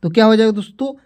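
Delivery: normal talking voice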